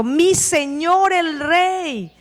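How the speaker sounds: slow, drawn-out call to the king. A narrator's reading. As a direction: call after the king with reverence. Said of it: "dio voces detrás de Saúl". (llamada lenta y respetuosa al rey)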